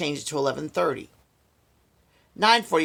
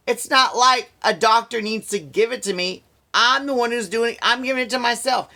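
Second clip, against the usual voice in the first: higher, louder, exasperated venting; indignant rant; 7 semitones higher, 4 dB louder than usual. Real, not acted. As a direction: let the frustration pour out. Angry rant about the treatment.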